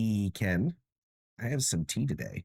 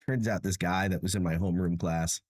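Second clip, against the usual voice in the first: ordinary delivery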